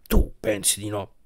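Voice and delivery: low, quiet, conflicted question; torn and hushed. A narrator's reading. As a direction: ask it softly, torn inside. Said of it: "ma combattuto disse".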